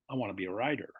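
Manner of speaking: American accent, T softened to D in "rider". Shown as a demonstration. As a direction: do American, letting the T's slacken.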